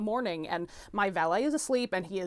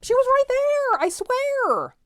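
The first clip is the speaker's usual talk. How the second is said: desperate shout; 9 dB louder than usual. Acted. Insisting to doubting valet.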